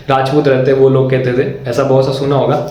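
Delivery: normal talking voice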